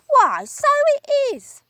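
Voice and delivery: put-on squeaky old wife, surprised; Missus Twit, high. Wife looking at her stick, high and startled. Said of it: "Missus Twit said".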